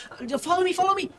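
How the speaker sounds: put-on high-pitched child calling them along; eager child guide. Child guide urging the party to follow, high-pitched. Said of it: "he just goes".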